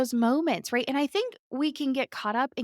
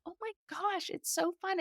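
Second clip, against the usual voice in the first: high and much softer, a delighted near-whisper; hushed delight; 8 semitones higher, 8 dB quieter than usual. Retold or imitated. Mom privately delighting in her growing kid, soft high gush. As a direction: gush it quietly, almost to yourself.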